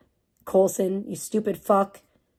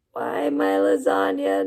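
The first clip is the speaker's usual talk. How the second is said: much lower, slow mock-tragic moan; hammed-up wail; slower than usual. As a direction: groan it like your lasagna died. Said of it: "I would have been like".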